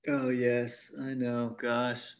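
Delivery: usual speaking voice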